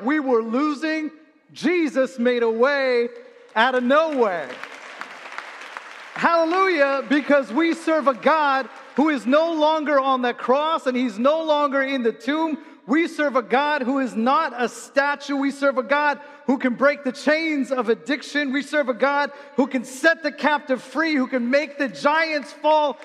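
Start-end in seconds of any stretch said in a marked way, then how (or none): 1.5-21.1 loud, high, rousing sermon shout; full-force preaching. Acted. 21.1-23.0 a full-throated preacher's shout. Acted.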